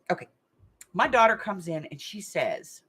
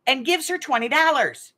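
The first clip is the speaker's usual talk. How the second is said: normal talking voice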